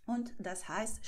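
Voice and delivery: usual speaking voice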